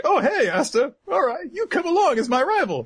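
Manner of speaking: very high, gleeful yelling; excited high shout. Retold or imitated. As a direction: shout it like a thrilled rival. Leo voiced excitedly.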